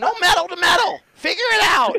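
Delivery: very high, loud mocking shout; imitating Scott. Scott brushing off his mechanic, high and loud. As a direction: bark it high like a know-it-all. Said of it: "I'm like".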